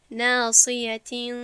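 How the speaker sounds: ناصیتین with the final n hidden in a held nasal hum; ikhfa. Shown as a demonstration. Teacher reads the verse to show the difference, ikhfa on ناصیتین. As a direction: draw out the blurred nasal ending of ناصیتین. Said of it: "اخفا کردم که یک قانون جداست".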